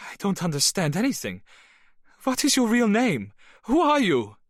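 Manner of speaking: rising to high, loud cries; distressed demand. Acted. Bernadette demands her lover's real name, crying out high and loud.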